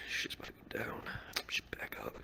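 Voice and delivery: hushed, whispered aside; whispered alarm. Retold or imitated. Bystander warns himself as fight starts, whispered alarm.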